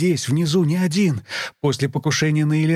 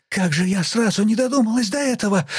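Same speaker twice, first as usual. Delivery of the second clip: high, eager sudden realization; excited; 9 semitones higher than usual. Acted. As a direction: burst out with a bright idea. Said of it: "В состоянии крайнего возбуждения".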